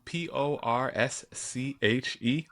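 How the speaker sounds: slow, each letter spelled separately. Shown as a demonstration. Spelling the car's name to the listeners, slowly letter by letter. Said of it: "I don't know why it irks me so much".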